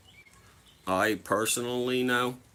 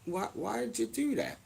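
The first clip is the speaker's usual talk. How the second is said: much higher and softer, hammed-up confusion; mock baffled; 14 semitones higher, 5 dB quieter than usual. Confronting the mascot after being jabbed, much higher mock bafflement. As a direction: play the stunned innocent.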